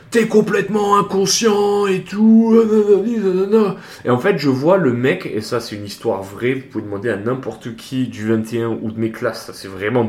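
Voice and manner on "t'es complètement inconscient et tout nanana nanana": high-pitched scolding yell, slow and pushy; corporal yelling. Retold or imitated. A corporal scolding the recruit after the fight, mock angry yell. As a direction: mimic a corporal ranting at a reckless recruit. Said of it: "qui commence à me gueuler dessus".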